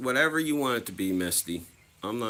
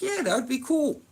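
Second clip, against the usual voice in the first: much higher, hammed-up eager kid; mock eager; 4 dB louder than usual. Answering his sister about the picture, much higher mock eagerness. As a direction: sell the giddy yes. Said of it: "And I was like".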